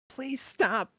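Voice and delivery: high, loud desperate plea; begging, pleading. Acted. Wicked begs Feynman as she shakes him, pleading. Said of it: "she's just begging, pleading".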